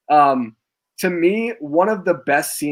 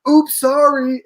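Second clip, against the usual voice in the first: high, loud comic "oops"; badly faked accident, sung out brightly; mock apology; 10 semitones higher, 5 dB louder, much slower than usual. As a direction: imitate a hammed-up fake-innocent apology. Repeats the fake-accidental intrusion.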